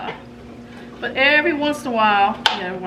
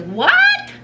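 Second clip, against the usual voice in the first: a high shrieked what, much louder; shocked what; 8 dB louder than usual. Retold or imitated. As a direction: squeal it out in total disbelief. Teen daughter shocked on phone.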